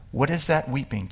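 ordinary delivery

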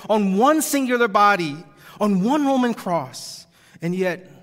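soaring, loud preaching climax; preaching at full force. Acted. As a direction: build it up to a fervent peak.

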